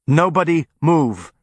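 loud, slow and forceful. A narrator's reading. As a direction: hammer the order home. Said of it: "this time with more force".

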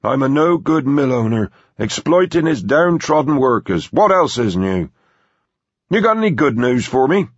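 put-on high-pitched grumbling old boss; grumpy. A narrator's reading. Boss conceding point and asking for good news, grumpy high voice. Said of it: "Philip Evans said grumpily".

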